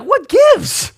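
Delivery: high mock indignant what gives; a lightly voiced resentful sibling; inner grumble. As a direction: squeak it out like a wronged sibling. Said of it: "a little part of you that's like".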